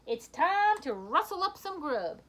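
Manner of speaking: high, loud folksy cowhand call; Bernie's cowboy call. Acted. Husband rounding up his bulldog, cowboy call. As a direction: herd the dog like a cowboy. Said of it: "Bernie said".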